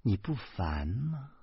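soft, slow, tender question; gentle. Acted. Teacher leans close to the man, softly tender. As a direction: ask it tenderly and unhurried. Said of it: "李爱佳的声音 越来越温柔".

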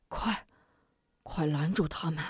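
weak urgent plea. Acted. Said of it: "摆手阻止".

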